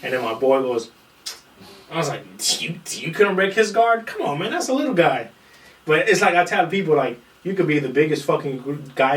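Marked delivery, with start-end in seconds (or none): 2.6-5.3 mock disbelief. Friend ribs the big guy, high mock disbelief. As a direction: rib him in a high, incredulous voice. Friend mocking the bigger opponent.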